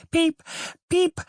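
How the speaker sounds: tiny, very high peep of hatchlings; cheeping chicks. A narrator's reading. Newborn ducklings cry out from their shells, very high peeps. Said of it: "its head and cried".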